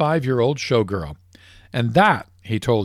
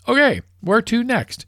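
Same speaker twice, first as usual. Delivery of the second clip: high, keen question; a hammed-up, thrilled golfer; eager golfer; 10 semitones higher than usual. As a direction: ask like the lucky streak won't end.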